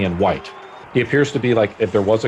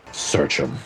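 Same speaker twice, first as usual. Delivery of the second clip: orc order; 6 semitones lower, 5 dB quieter than usual. Acted. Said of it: "and the orc says".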